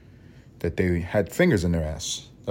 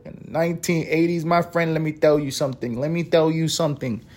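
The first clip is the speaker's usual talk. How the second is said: high, put-on character voice; exaggerated high-pitched buttonholing; hammed-up storyteller; 7 semitones higher than usual. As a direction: play up a tall-tale teller. Performs storyteller voice on crack era.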